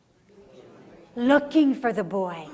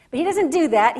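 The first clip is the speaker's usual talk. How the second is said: ordinary talk, nothing marked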